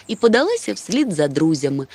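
ordinary delivery

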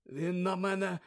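dazed slow mumble, foreign voice; slurred, still groggy; dazed Krum mumbling after waking. Acted. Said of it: "пробелькотів Крум".

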